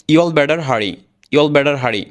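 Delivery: "you all better hurry" said fast, t as d in better; y'all beddar hurry, flowing; quick native form. Shown as a demonstration. Teacher showing the fast American way: flap t. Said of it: "খুব দ্রুত বলবে এরা".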